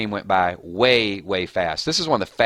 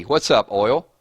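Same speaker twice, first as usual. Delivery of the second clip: usual speaking voice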